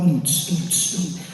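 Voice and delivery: imitated thumping jukebox bass and hiss; vocal beatbox thump. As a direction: mouth the beat of a bar jukebox. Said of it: "the jukebox is going".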